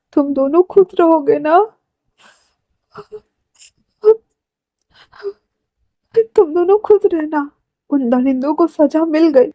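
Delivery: high-pitched, choked-up blessing; tearful farewell. Acted. Brother blessing the dead couple, tearful. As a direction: bid the couple goodbye through tears, pitch climbing.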